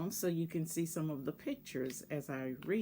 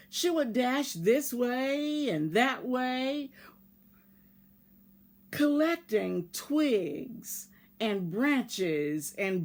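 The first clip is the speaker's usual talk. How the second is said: lively read-aloud voice, bigger than her chat; storybook narration; 2 semitones higher, 5 dB louder than usual. Acted. Teacher reading a picture book to her class, expressive storytelling. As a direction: tell it like a bedtime story to children.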